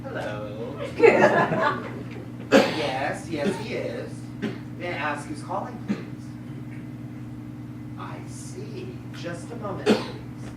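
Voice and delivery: low, hushed telephone manner; careful, subdued phone voice; polite phone answering. Acted.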